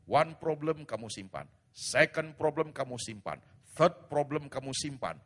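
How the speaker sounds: preaching shout. Real, not acted. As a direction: hammer each problem home. Pastor preaching forcefully to congregation. (khotbah berapi-api, berteriak)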